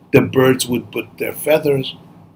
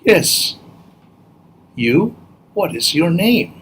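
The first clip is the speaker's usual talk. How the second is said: higher-pitched storybook bird; shorebird; 5 semitones higher than usual. Acted. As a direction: answer as a little bird, pitched up. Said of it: "the bird said".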